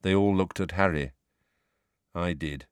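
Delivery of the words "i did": low, soft and downcast; glum. A narrator's reading. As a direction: admit it heavily and gloomily. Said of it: "he said glumly".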